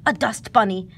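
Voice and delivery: ordinary delivery, nothing marked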